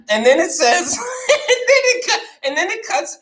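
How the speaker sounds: words squeezed out through laughter; laughing so hard voice cracks up high; real laughter. Real, not acted.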